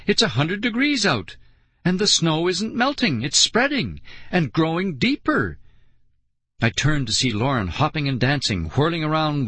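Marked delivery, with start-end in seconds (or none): 0.1-5.6 high, disbelieving protest; bewildered kid's high outcry; alarmed cry. A narrator's reading. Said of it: "I cried".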